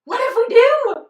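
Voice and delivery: high, loud excited squeal; thrilled squeal. Retold or imitated. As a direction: burst out giddy at the thought. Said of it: "I'm like".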